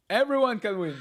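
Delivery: ordinary talk, nothing marked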